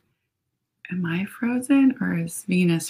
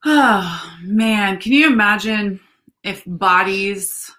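ordinary delivery

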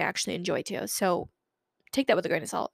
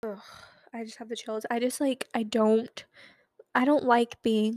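normal talking voice

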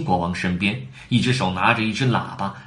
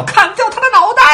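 very high, loud yell; bellow; 9 dB louder than usual. A narrator's reading. Raging Queen ordering a beheading, loud yell. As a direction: yell the death sentence. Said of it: "就是大叫".

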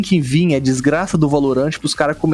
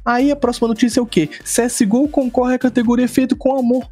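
indignant rant; 7 semitones higher than usual. Real, not acted. Indignant rant about the award.

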